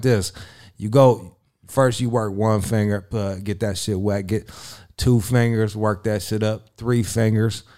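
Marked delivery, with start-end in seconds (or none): none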